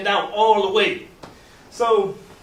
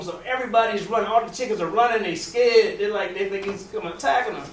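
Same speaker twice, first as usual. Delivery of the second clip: ordinary talk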